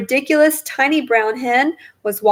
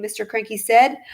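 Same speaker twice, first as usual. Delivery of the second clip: plain reading voice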